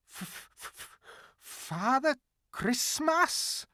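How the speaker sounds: whispered stutter, then slow and high; frightened stammer. Acted. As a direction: stammer it out in fear. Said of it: "stammered the fox".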